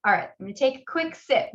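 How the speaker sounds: ordinary talk, nothing marked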